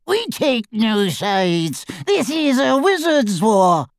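high, slow, proud goblin. A narrator's reading. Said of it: "the higher voiced of the goblins".

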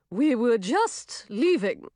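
slow, drawn-out fairy voice; displeased swamp fairy. A narrator's reading. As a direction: answer the queen with a frown. Frowning swamp fairy answers queen.

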